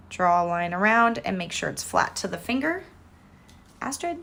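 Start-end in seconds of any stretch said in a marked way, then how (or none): 3.8-4.2 calling the cat. Real, not acted.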